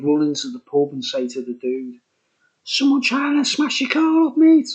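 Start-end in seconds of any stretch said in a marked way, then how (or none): 2.6-4.7 put-on high, alarmed kid's call, louder at the end; a boy bursting in to raise the alarm; urgent warning. Kid rushing into the pub to warn a driver, high and urgent.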